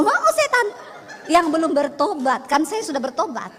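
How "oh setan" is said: mock loud reply. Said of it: "Dia datang ngomong sama saya".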